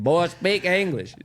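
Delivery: a hammed-up Alabama drawl, slow and loud. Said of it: "He's like".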